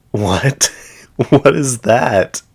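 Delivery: slow, incredulous laugh through the words; laughing at how absurd it sounds; laughing disbelief. Real, not acted. Baffled by the claimed illness.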